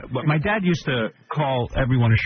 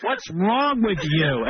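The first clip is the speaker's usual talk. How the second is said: scolding mother; 7 semitones higher, much slower than usual. Retold or imitated. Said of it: "She looks at Howard".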